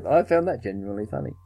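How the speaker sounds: ordinary delivery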